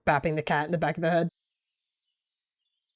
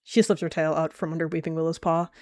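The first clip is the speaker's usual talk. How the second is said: usual speaking voice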